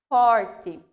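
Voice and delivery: "forte" said loud and strong; emphatic word. Shown as a demonstration. Teacher stressing the strong R. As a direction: punch "forte" out loudly. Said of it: "fazer o som".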